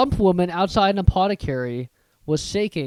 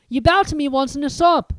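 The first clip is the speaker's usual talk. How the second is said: higher, lightly voiced young boy; a shy boy remembering; 10 semitones higher, 5 dB louder than usual. Narrator voices Harry.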